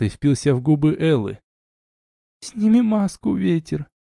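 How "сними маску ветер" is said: high, thin tearful female voice; sobbing plea. A narrator's reading. Wife begging husband to drop his mask, sobbing plea. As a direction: plead as if through a sob. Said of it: "всхлипнула она".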